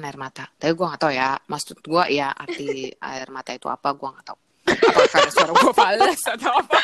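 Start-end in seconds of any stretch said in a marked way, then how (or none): 4.7-6.8 high, loud laughing question; laughing at own off-key singing. Real, not acted. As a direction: ask it through a burst of laughter.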